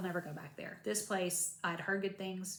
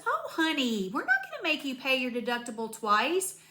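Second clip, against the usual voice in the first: higher, louder sweet reassurance to a patient; sweet front-desk lady; 5 semitones higher, 6 dB louder than usual. Retold or imitated. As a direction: mimic the kind clerk saying honey. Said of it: "And she goes".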